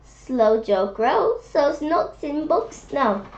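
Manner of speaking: usual speaking voice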